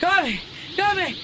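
high, loud battle cry; parodying anime power moves, shouting; anime attack yell. Pretend. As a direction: scream the attack like a cartoon fighter.

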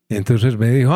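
ordinary delivery

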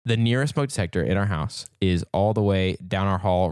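ordinary delivery

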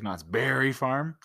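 Berry lifted with a rise, said slowly; stress on Berry. Shown as a demonstration. Husband demonstrating where the emphasis goes on Berry. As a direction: lean on Berry in Knott's Berry Farm. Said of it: "Where do you put the emphasis?".

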